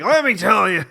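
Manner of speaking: loud put-on Farley voice; Chris Farley impression. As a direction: do a brash Farley. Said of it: "He would have been, like".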